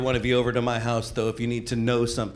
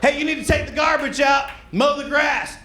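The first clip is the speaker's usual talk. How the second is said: nagging dad; 14 semitones higher than usual. Retold or imitated. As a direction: yell the chores like a fed-up dad. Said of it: "parent barking chores".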